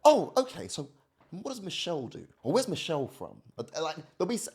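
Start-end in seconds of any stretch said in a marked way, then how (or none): 0.0-3.4 imitating mum. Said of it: "Anytime I say to her".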